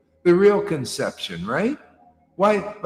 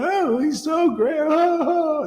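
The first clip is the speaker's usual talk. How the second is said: high, gushing adoring woman; swooning devotee; 14 semitones higher, 4 dB louder than usual. Retold or imitated. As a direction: gush like a starstruck fan. Women in saris adoring guru.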